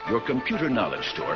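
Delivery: ordinary talk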